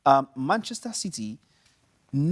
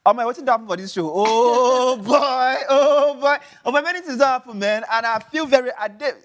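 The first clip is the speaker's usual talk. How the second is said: loud, high Nigerian pidgin English with a laugh; 11 semitones higher, 11 dB louder than usual. Acted. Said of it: "Okay, go for pidgin".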